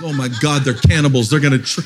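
hammed-up alarmed cry; mock horrified shout. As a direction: play up the shock of seeing your photo. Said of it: "I'm like".

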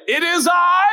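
high, loud proclamation as Jesus; booming announcement. Acted. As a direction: call it out grandly over a storm. Acting Jesus announcing himself over storm.

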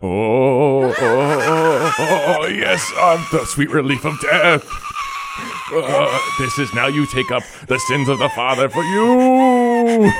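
high hammy villain crowing; gleeful villain. Acted. Villain gloating over son's death.

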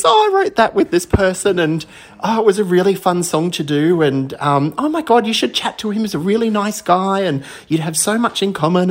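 high, bubbly chatter of a gracious star; imitating Danny. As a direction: breeze through it like a star putting a fan at ease. Star chatting, retold by fan.

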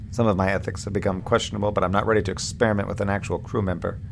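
normal talking voice

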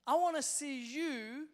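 slow, loud preaching cry; impassioned sermon appeal; fervent preaching. Acted. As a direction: lean into it like a preacher. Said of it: "waxing lyrical".

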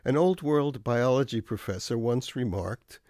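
ordinary talk

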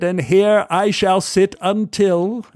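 a polite English gent's greeting; English accent. Retold or imitated. English stranger greets narrator at the bar, lightly English. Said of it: "he said".

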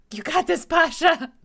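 high, loud cheer for a friend. Acted. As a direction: cheer her on, laughing. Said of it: "I just say".

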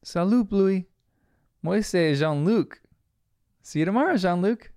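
lightly voiced young characters calling to each other; children calling goodbye. Children's goodbye exchange acted out.

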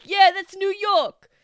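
high, loud brash New Yorker; Will Sasso New York bit. Retold or imitated. Podcaster quoting a Will Sasso bit in loud New York voice. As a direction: belt it like a cartoon New York guy. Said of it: "but he's like".

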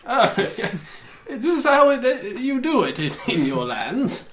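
high, giggling confusion; nervous laughing banker. Acted. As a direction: laugh through it awkwardly. Banker laughing at fist bump.

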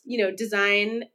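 normal talking voice

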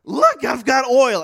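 slow, loud exclaim with a put-on voice; mock excited believer. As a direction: ham up a thrilled churchgoer showing his hands. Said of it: "people were like".

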